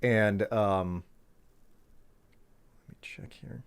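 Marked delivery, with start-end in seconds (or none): 2.9-3.6 soft whisper to himself while checking; whispered aside. Real, not acted. Gamer whispering to himself while checking the map, hushed. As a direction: breathe it under your breath.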